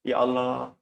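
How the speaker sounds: ordinary delivery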